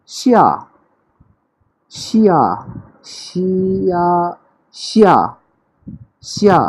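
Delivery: श्या high and sharply falling; श्या clipped, falling from high; fourth tone xia. Shown as a demonstration. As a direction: Mandarin 下 falling tone. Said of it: "इसका opposite है".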